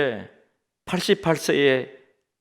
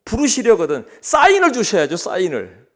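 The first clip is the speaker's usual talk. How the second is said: acted protest, pitched up then dropping; indignant complaint; 5 semitones higher, 5 dB louder than usual. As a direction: complain like a young man cheated of warning. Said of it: "막 화를 내는 거예요". (화내며 따지듯이)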